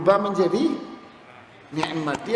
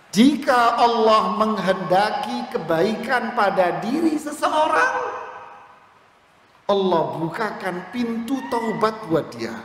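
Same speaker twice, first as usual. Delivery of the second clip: preaching; 5 semitones higher than usual. Real, not acted. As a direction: proclaim the promise with fervour. Preaching at full force.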